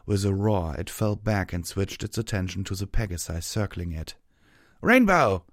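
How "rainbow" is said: loud, high calling shout; shouting from above. A narrator's reading. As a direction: call out across the battle. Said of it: "Tailwind shouted from above".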